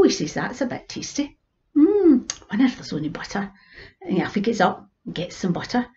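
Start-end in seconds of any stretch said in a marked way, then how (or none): 0.3-3.5 whispered, scheming to himself; greedy whisper. Acted. Old man whispering over tempting bannocks. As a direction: hush it, eyeing the bannocks.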